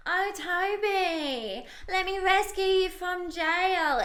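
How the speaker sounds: high, drawn-out mimicry; Chloe. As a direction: wail it slowly and high, hammed-up. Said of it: "mimicking Chloe in the villa".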